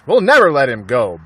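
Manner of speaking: loud firm vow; declaring. A narrator's reading. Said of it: "Bunce declared".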